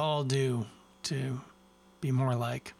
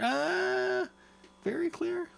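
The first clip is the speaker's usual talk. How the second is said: much higher, hammed-up "very clear"; mock snobby; much slower than usual. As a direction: say "very clear" up high like a pompous taster. Mock snobby repeat of clarity.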